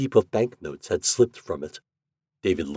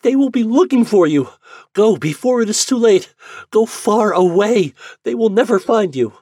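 put-on much higher, louder desperate girl; pleading Yuli; 9 dB louder than usual. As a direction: beg him to flee.

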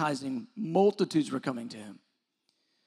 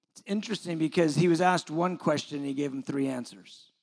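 ordinary delivery

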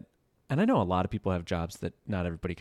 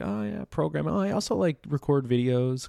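high-pitched, noncommittal self-imitation; mock yourself casting about for an easier answer; hemming and hawing; 7 semitones higher than usual. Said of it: "I hem and haw".

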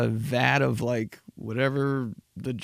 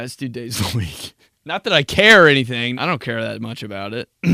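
usual speaking voice

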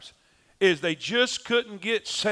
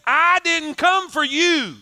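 impassioned preaching; 7 semitones higher, 5 dB louder than usual. Acted. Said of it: "And Jesus is saying".

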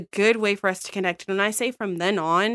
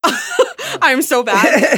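laughing, very slowed and louder; 8 semitones higher, 7 dB louder, much slower than usual. Real, not acted. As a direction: laugh through the confession. Laughing at own golf skills.

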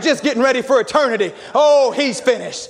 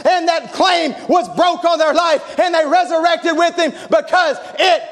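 preaching at full force; 8 semitones higher than usual. Acted. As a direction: rouse the church, voice soaring and pounding.

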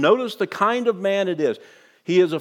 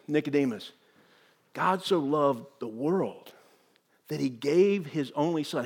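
quiet and intimate; 3 semitones lower, 5 dB quieter than usual. Acted. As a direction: say it gently, looking him in the eye. Jesus speaks to one man.

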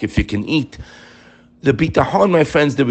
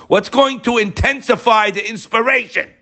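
high-pitched, impassioned preacher's question; emphatic repeat; 13 semitones higher than usual. Real, not acted.

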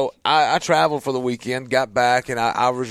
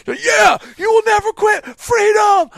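much higher, loud rousing shout; fired-up dad yelling freedom; battle cry; 9 dB louder than usual. Acted. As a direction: roar it like a war speech. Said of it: "I'm hitting all the stuff".

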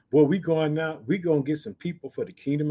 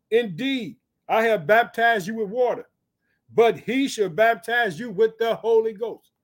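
higher, deliberate pulpit reading; scripture declamation; 5 semitones higher, 4 dB louder, slower than usual. Acted. Preacher reads John's promise of baptism, declaiming. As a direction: proclaim the promise phrase by phrase.

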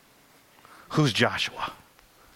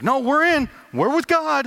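high, loud eager people insisting; eager crowd; 11 semitones higher, 3 dB louder than usual. Retold or imitated. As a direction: chime in like an overeager crowd. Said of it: "And they're like".

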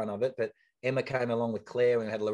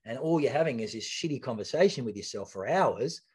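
ordinary talk

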